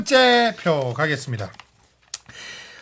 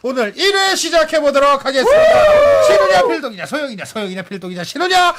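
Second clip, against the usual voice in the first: hyped giveaway announcement; 5 semitones higher than usual. Acted. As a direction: build the hype for the award. Host hypes awards.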